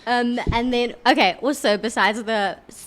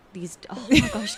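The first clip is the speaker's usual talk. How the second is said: whispered aside; 2 semitones higher than usual. Real, not acted. Embarrassed slip, whispered aside.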